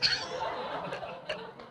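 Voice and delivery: breathy, voiceless burst of laughter; real laugh. Real, not acted. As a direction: let a long laugh spill out. Laughs at the punchline.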